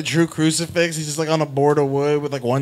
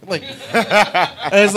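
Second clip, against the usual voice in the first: ordinary talk, nothing marked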